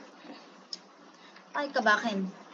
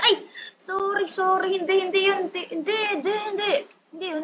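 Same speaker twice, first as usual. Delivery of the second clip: flustered denial; 7 semitones higher, 3 dB louder than usual. Real, not acted. Panicked apology to angry player.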